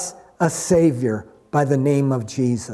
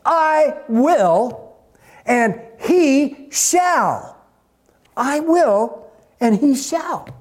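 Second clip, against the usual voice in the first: high, drawn-out "I will" and "he shall"; preacher hammering "will" and "shall", pitched high; emphatic declaration; 7 semitones higher, much slower than usual. Retold or imitated. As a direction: proclaim "I will, and he shall" word by word, with pauses. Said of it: "God said it emphatically without condition".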